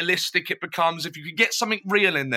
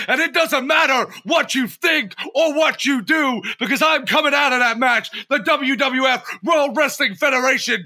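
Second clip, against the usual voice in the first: higher, louder shouted wrestler trash talk; wrestling promo voice; 7 semitones higher, 4 dB louder than usual. Pretend. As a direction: bellow it like a WWF heel cutting a promo. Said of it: "like my wrestling voice".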